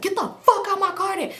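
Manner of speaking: high-pitched mock-angry outburst; exasperated high command; angry order, retold. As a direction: act out throwing someone out. Acted ordering him out of car.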